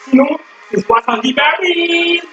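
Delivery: barking gym coach. Acted. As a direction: pump the class up like a fitness coach. Leads workout over music.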